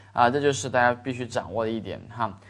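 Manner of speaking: ordinary talk, nothing marked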